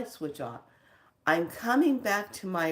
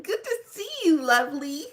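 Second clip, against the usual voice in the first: high and a bit louder, affectionate tone; high greeting; 9 semitones higher, 5 dB louder than usual. Acted. Greeting a friend, high and affectionate. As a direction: keep the voice up high and sweet.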